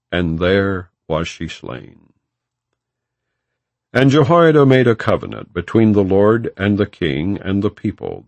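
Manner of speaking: ordinary talk, nothing marked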